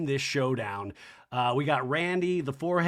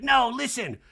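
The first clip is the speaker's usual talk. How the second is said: higher mock protest; Kyle arguing; 9 semitones higher, 4 dB louder than usual. Kyle interrupting Dorit's telling-off, mildly acted insistence.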